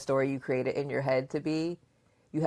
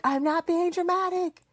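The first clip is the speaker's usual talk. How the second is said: much higher, whiny mock protest; imitating daughter. Mother imitating her daughter's protest, high whiny mock voice. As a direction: squeak it out like a sulky kid denying it. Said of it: "And you're like".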